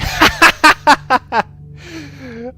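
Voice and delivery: big burst of high laughter; loud cackling laugh. Real, not acted. Reaction to Melbourne ruins.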